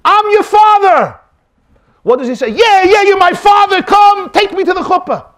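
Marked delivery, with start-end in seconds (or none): 0.1-1.2 high, loud, drawn-out proclamation; dramatic announcement. Acted. Man walks in to adopted boy, dramatic announcement. 2.5-5.3 high, loud, gushing acceptance; mock eagerness. Adopted boy to supposed father, exaggerated eagerness.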